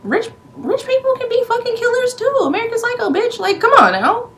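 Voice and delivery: high exasperated outburst. Real, not acted. Vlogger ranting at Dorothy, high and incredulous. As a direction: throw your hands up in disbelief.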